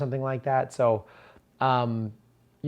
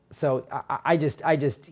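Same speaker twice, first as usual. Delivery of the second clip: usual speaking voice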